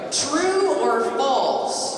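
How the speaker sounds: playful teacher quizzing the audience; quiz host. Pretend. Quiz host posing question.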